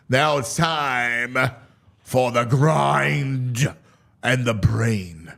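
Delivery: slow, drawn-out announcer intro; slow radio-host lead-in; segment announcer. Acted. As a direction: build it up like a radio bit.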